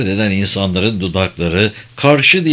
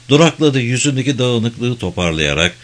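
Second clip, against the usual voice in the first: ordinary delivery, nothing marked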